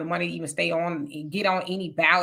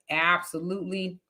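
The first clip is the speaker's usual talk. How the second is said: usual speaking voice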